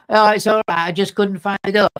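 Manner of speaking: high, loud cheery Manchester woman; chirpy Manchester voice brushing it off; Manchester accent. Retold or imitated. The woman answering the vicar, in a high loud Manchester accent. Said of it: "Manchester accent".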